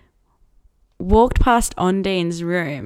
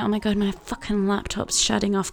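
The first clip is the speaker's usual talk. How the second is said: ordinary delivery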